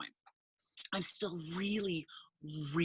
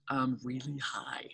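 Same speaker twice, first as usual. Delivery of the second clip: whispered, slow, guilty admission; sheepish whisper; 4 semitones lower, slower than usual. Retold or imitated. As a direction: confess it under your breath. Sheepish confession to dad.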